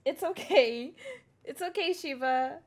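high, soothing pet talk; calm the worried dog; reassuring the dog. Real, not acted. Streamer telling a worried dog it's okay, high soothing.

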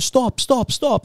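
high-pitched imitated calls to stop; urgent team shout. Whole team calling to their fighter to stop celebrating, urgent mock shout. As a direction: call out like alarmed teammates.

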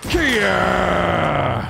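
high karate kiai; mock battle cry. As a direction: yell like a cartoon fighter.

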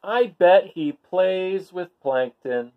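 imagine the fun you're missing; envious barnacle. Acted. Bored barnacle envies the fish, high and wistful.

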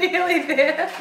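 laughing. Real, not acted. Laughing encouragement.

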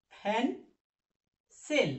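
"ফ্যান সেল" as two separate beats with a long gap; teacher splitting "ফ্যান সেল" syllable by syllable; broken into syllables. Shown as a demonstration. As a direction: say "ফ্যান", pause, then "সেল". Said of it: "syllable ভাঙলে কি হবে বলো তো".

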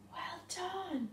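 whispered praise. Real, not acted. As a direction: softly whisper well done. Praise whispered to resting kids.

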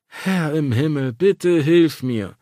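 slow, exasperated plea to heaven; hammed-up prayer for patience; mock pleading. A narrator's reading. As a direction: beg the heavens, slowly and theatrically. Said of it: "flehte ich".

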